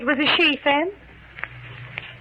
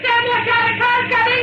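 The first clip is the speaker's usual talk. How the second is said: frantic warning; 10 semitones higher than usual. Acted. Wife screaming a warning as the car rushes at her husband, frantic. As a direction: shout out to save him.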